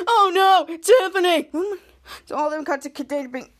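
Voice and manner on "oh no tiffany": hammed-up tearful cry, louder; imitating a sobbing cry of no; mock wail over Tiffany. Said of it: "crying and said".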